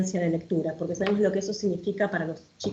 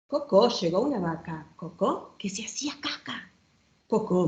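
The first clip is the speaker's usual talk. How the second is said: playful, sing-song read-aloud with bouncing rhyme; reading to children; 4 semitones higher than usual. Acted. Showing a children's book to the audience, lively storytime reading.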